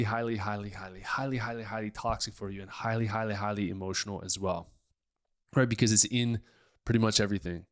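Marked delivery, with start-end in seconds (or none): 0.0-4.7 hushed repetition. Acted. Mock hushed exaggerated repetition.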